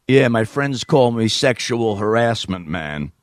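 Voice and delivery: slow, drawn-out New York caller; New York accent. Acted. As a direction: introduce yourself slowly in New York accent. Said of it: "me doing a New York accent".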